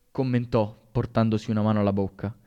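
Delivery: usual speaking voice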